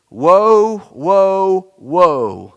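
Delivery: eagle crying woe, loud and high. Acted. Preacher voicing the eagle's warning cry to the congregation, loud. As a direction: cry it out like a warning bird overhead. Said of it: "crying with a loud voice".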